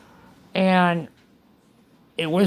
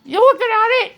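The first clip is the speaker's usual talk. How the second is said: much higher and louder, cheeky; triumphant retort; 12 semitones higher, 5 dB louder, faster than usual. Retold or imitated. Patient retelling her cheeky reply to doctors, triumphant. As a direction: throw it back with a grin.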